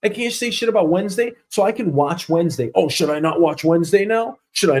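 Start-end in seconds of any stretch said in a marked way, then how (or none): none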